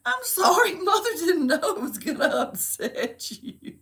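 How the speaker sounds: high, loud mother's apology broken by laughter; laughing retold apology. Mother retelling her apology to her son, laughing. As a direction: imitate consoling a child while cracking up. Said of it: "I said, "Oh, son".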